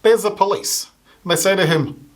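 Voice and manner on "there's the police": ordinary delivery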